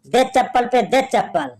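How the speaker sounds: ordinary delivery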